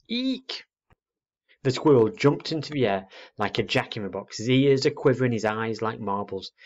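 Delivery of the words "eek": very high, sudden "Eek!"; owlet's screech. A narrator's reading. Owlet startling a squirrel on the branch below, piercing squeak. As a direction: squeal it like a startled little creature. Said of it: "his very loudest".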